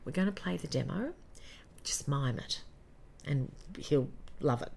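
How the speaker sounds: quietly confiding; hushed aside. Retold or imitated. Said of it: "producers took me aside and said".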